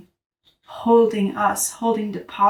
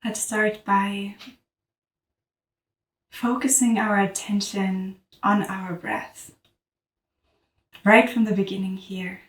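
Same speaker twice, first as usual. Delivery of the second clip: guided meditation; 4 dB quieter than usual. Real, not acted. Teacher guiding listeners to notice their breath, calm meditative voice. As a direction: guide the breath gently, leaving long silences.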